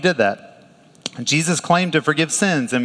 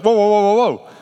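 mock alarmed whoa; 7 semitones higher, 4 dB louder than usual. Religious leaders react to Jesus.

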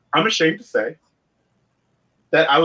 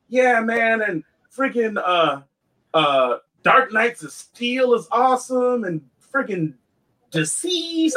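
high-pitched fan enthusing to himself; retold excitement; 7 semitones higher, slower than usual. Comic fan recalling sitting at the panel, excited. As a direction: relive eager anticipation, voice lifted. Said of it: "so I was really excited".

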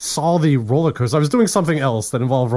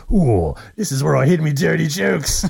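imagined sneaky guy; 3 semitones higher than usual. Pretend. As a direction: play a gleeful hoarder of dirty secrets. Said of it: "there's this guy that's like".

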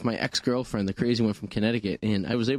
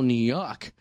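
usual speaking voice

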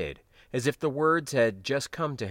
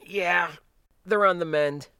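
higher, louder younger voice; young man voice; 8 semitones higher, 5 dB louder than usual. Acted. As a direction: voice him a bit brighter and higher. Ejak answers in character voice.

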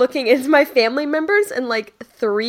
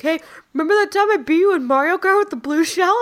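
taunting husband; 7 semitones higher, faster than usual. Retold or imitated. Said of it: "being like".

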